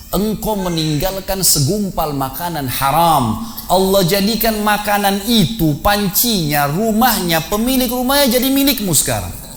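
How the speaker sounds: slow, then climbing high with wonder; slow awed lines rising to a high climax; rising awed declaration. Retold or imitated. Wife's triumphant faithful reply.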